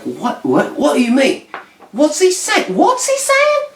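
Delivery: mock outrage climbing to a squeal; outraged British cat. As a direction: voice shooting up very high. Acts British cat outraged at boast.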